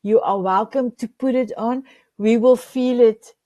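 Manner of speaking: ordinary delivery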